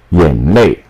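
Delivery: normal talking voice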